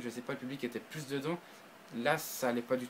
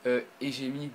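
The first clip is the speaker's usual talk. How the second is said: normal talking voice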